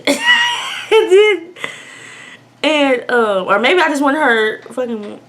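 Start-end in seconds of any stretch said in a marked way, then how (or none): 0.0-1.5 high, loud laughing. Real, not acted. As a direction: break into laughter mid-line. Burst of laughter.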